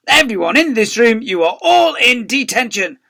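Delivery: high, loud furious snap; snapped. A narrator's reading. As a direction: snap and sentence the whole room. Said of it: "before Seeth snapped".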